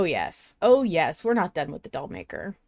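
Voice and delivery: ordinary talk, nothing marked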